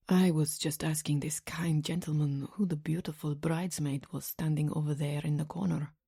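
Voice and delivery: put-on quiet, suave Italian-accented man; soft-spoken Italian gentleman's accent; strong Italian accent. A narrator's reading. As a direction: speak softly with an Italian accent. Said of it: "in a strong Italian accent".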